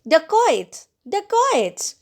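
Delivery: high-pitched cry of alarm, climbing on repeat; shrieked alarm. A narrator's reading. Girl warning family of approaching bandits, shrieked alarm. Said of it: "I shrieked and pointed at them".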